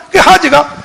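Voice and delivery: ordinary talk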